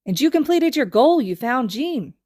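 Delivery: high, loud excited gush; bursting with admiration for his friends. A narrator's reading. Zushi gushing to Gon and Killua, high and excited. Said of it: "Zushi gushes".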